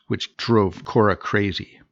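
plain reading voice

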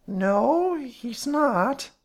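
high, drawn-out wary denial; suspicious. A narrator's reading. Fat woman asked for the superintendent, suspicious. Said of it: "she said suspiciously".

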